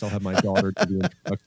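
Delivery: ordinary talk, nothing marked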